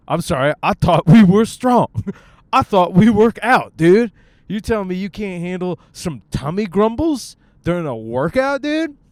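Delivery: loud, high, incredulous gym bro; hammed-up loud pep-talk rebuke; mock indignant scolding. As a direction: berate your own stomach in mock outrage. Acted scolding of own stomach.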